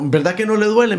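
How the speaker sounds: high, loud, insistent coaxing; grandfather coaxing. Retold or imitated. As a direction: imitate grandpa pressing a child to agree. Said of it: "me decía".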